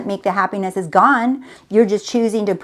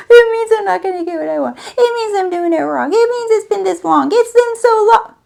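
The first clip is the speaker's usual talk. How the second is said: mock whiny self-pity; 10 semitones higher than usual. Voicing a despairing inner story.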